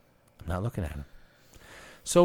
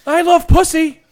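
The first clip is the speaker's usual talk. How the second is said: high, loud mock holler; hammed-up yell; screaming old man; 9 semitones higher, 10 dB louder than usual. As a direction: shout it out in imitation of him. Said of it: "He's screaming".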